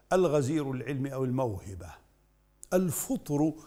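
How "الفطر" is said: الفطر with a short u after the ف; damma on the fa. Shown as a demonstration. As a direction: say الفطر as al-fuṭr, with "fu". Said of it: "بضم الفاء". (الفطر بضم الفاء)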